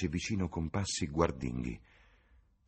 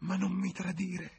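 much higher whining beg; whimpering plea; 13 semitones higher than usual. A narrator's reading. Tavern woman whines to James not to betray her. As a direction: whimper like a frightened old woman. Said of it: "piagnucolò".

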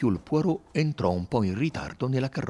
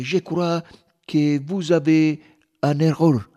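broken schoolboy French, louder; halting French; 8 semitones higher, 11 dB louder, slower than usual. Acted. American tourist struggling to speak French. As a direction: stumble through the French. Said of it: "in un francese stentato".

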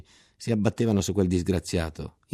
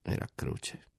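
Era croce sussurrato, molto più piano; sussurro; 8 dB quieter, slower than usual. A narrator's reading. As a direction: ripeti Era croce tra sé a fior di labbra. Ripetuto sottovoce. (Era croce, sussurrato tra sé)

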